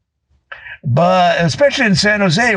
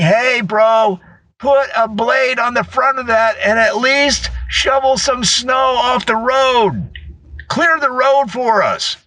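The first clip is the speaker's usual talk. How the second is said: a raised, mocking call from the roadside; lightly voiced passer-by heckling, pitched up; mock passer-by yell; 7 semitones higher than usual. Passers-by yelling at speeding truck.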